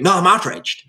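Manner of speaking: a stubborn complainer digging in; hammed-up "No, I'm outraged"; mock outrage. Mock dialogue, outraged person.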